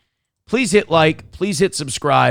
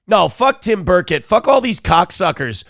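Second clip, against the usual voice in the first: normal talking voice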